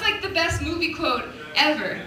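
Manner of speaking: ordinary talk, nothing marked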